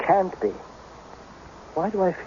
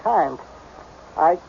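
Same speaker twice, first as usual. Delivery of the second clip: ordinary talk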